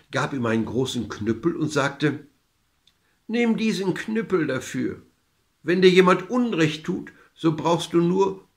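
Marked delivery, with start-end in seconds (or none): 3.3-8.5 put-on higher-pitched old woman giving directions; lightly voiced old woman, emphatic advice; old woman instructing. A narrator's reading. Old woman hands the man a cudgel, higher instructing voice.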